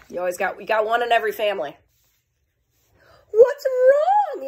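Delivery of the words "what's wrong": high, loud worried question; kind concerned friend. Acted. Best friend Nebraska checking on Kansas, high and caring. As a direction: ask gently but with big concern. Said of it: "kindly asked him".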